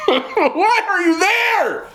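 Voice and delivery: high, drawn-out exasperated cry with laughter; animated yelling. Real, not acted. To friends who stay with a partner they dislike, animated yelling. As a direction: yell it way up high, stretching the words. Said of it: "I'm sorry, I'm yelling".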